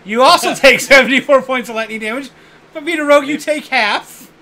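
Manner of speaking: loud, high dramatic damage call; dramatic announcement. Acted. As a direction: boom out the damage.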